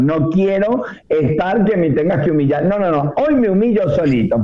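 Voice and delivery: ordinary delivery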